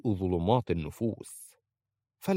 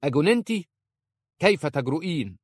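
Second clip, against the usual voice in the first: high, louder indignant challenge; angry; 8 semitones higher, 4 dB louder than usual. A narrator's reading. Sheikh's wife to a midnight visitor, angrily. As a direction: scold the intruder in an outraged, raised pitch. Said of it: "وقالت في غضب".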